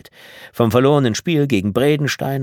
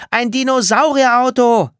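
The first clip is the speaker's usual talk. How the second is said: high-pitched, loud excited yell; calling through the house; 7 dB louder than usual. A narrator's reading. Martha announces the dinosaur car, calling through the house. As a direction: shout it for the whole house to hear. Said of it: "Martha rief es durch das ganze Haus".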